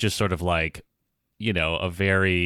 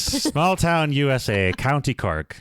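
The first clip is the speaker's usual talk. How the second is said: put-on Irish accent; 4 semitones higher, slower than usual. Corrected role line.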